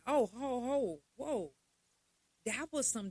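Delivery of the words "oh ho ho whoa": mock shocked reaction. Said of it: "I'm like".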